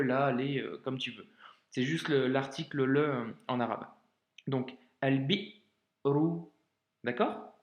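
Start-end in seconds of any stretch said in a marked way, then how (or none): 5.0-6.4 slow al-bi'ru, cut short after "bi"; drawn-out demo of "Al-bi'ru" with a catch after "bi"; hamza blocked. Shown as a demonstration. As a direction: say "Al-bi'ru" syllable by syllable, stopping hard on the hamza.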